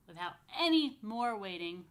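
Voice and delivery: usual speaking voice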